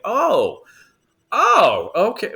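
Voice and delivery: high, loud dawning "oh"; retold surprise. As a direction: act out suddenly being impressed. Retold surprise at hearing HBO.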